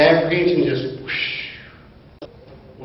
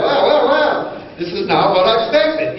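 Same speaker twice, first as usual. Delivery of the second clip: amazed; 8 semitones higher, 4 dB louder than usual. Retold or imitated. His own amazed reaction retold.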